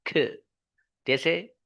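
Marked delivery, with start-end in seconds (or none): 0.1-0.2 lone "ख" with a short puff of air; aspirated K sound. Shown as a demonstration. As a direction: release "ख" airy and brief. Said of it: "C sound is". (हवा वाली "ख" की आवाज़)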